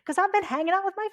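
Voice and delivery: put-on Southern twang, high and loud at first. Speaker mimicking family back in Southwest Virginia, Southern twang. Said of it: "that with a Southern accent".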